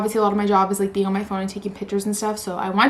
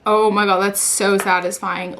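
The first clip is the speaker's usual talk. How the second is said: ordinary talk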